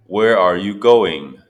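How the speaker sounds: usual speaking voice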